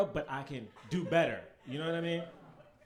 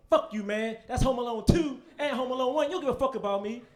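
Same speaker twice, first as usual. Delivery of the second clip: loud, high mock outburst at a friend; mock shout; 7 semitones higher, 10 dB louder than usual. Retelling yelling at his friend. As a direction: yell it at him in fake outrage.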